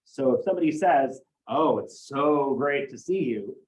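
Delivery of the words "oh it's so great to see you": slow, drawn-out mock delight; demonstrated sarcastic greeting. Shown as a demonstration. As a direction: stretch "so great" with insincere pleasure. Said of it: "saying it in a sarcastic tone of voice".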